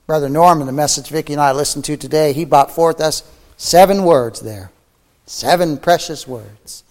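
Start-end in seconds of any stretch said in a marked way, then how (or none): none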